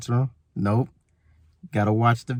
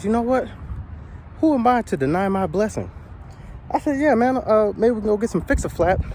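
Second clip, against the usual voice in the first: excited pitched-up self quote; 8 semitones higher than usual. Retold or imitated. Quoting himself, excited.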